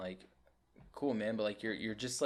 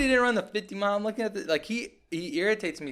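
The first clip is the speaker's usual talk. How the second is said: much higher and louder, exasperated; worked-up rant; 10 semitones higher, 8 dB louder than usual. Real, not acted. Complaining to a friend about a corny influencer, high excited rant. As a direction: vent at the top of your voice.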